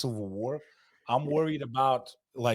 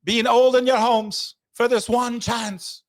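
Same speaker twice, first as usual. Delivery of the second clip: slow, high, loud battle cry; rallying speech; 13 semitones higher, 10 dB louder, slower than usual. Retold or imitated. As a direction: shout it like a warlord rallying troops. Starting the Braveheart quote.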